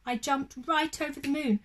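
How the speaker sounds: put-on gentle storybook narration for children; lively read-aloud voice for little ones; bedtime story reading. A narrator's reading. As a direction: read it like a bedtime picture book.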